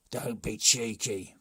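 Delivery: loud stern rebuke; chief scolds. A narrator's reading. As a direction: tell the boy off.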